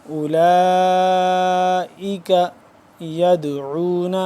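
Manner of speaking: slow melodic Quranic chant; Quran recitation. Shown as a demonstration. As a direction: recite it like tilawat with long vowels. Recites verse. (قرآن کی تلاوت کا انداز)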